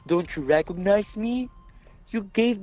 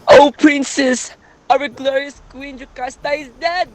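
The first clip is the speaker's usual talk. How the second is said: anguished cry; 6 semitones higher, 11 dB louder than usual. Acted. Messenger announces queen's death.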